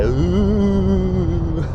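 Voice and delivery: lightly voiced ghost moan; a mock spooky ghost oooh; funny ghost. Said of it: "we just need a funny voice".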